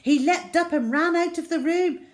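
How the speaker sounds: usual speaking voice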